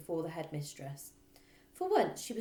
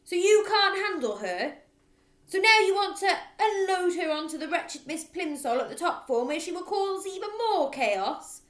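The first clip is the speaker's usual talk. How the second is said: very loud, higher accusing rant; furious accusation; 11 semitones higher, 11 dB louder than usual. Acted. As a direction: hurl the accusations. Trunchbull accusing.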